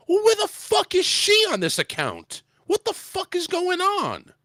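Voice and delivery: high-pitched indignant outburst; losing it. Retold or imitated. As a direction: demand answers in high-pitched outrage. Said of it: "I lost it in my, on my supervisor".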